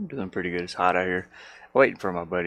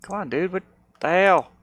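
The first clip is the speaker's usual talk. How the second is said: annoyed outburst; 10 semitones higher, 9 dB louder than usual. Real, not acted. Program won't respond.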